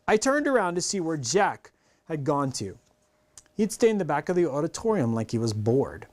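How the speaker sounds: plain reading voice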